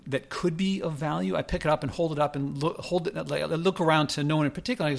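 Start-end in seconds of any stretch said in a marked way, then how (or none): none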